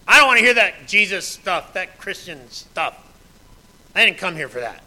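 loud, brushing off the gospel; dismissive outburst. Retold or imitated. Preacher mimics a listener refusing the gospel, loud and dismissive. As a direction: shove the message away, loud.

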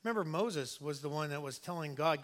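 usual speaking voice

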